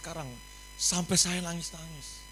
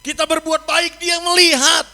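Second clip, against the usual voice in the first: loud, high, fervent preaching; preaching at full force; 10 semitones higher, 12 dB louder than usual. Acted. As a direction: shout the truth out to the church. (khotbah berapi-api dengan suara keras dan tinggi)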